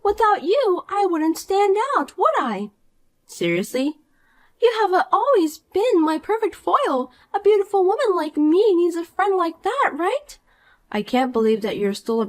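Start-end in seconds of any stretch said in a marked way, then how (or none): 0.0-10.4 put-on bright, higher voice of a smug mean girl; snide rich girl. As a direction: talk down to her with sweet contempt. Mean girl Poppy taunts Marissa.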